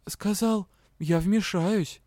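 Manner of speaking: ordinary delivery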